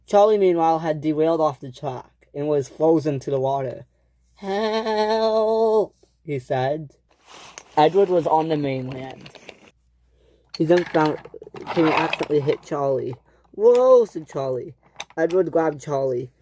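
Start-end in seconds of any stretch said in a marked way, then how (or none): 4.4-5.9 long, high drawn-out call; wailing plea in a raised pitch; cry for help. Acted. Frozen engine calls for help. 13.5-14.1 loud, high whoa; acted jolt of surprise; startled yelp. Engine gets hit.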